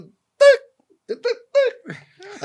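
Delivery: high, loud squawked "tic"; sharp high bird squawks, spaced apart; imitated rooster choking. Storyteller voices the rooster with a clogged gullet, high squawks. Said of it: "Ele falou".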